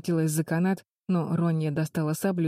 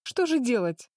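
exclaiming; 7 semitones higher than usual. Acted. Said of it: "воскликнула Флис".

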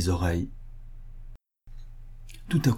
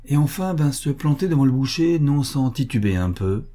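ordinary delivery, nothing marked

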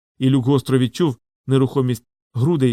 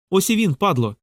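shout; 6 semitones higher than usual. A narrator's reading. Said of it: "крикнув Лок".

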